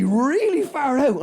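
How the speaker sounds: ordinary talk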